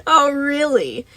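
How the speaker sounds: loud, high, drawn-out sarcasm; mocking. A narrator's reading. Moon taunts the protesting boy, loud and drawn-out. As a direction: stretch it out gleefully, taunting. Moon mocks the boy's denial.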